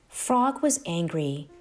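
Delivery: ordinary delivery, nothing marked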